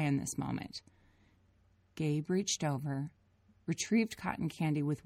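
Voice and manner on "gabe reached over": ordinary delivery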